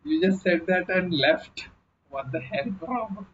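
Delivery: normal talking voice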